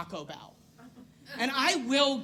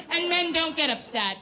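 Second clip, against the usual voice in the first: usual speaking voice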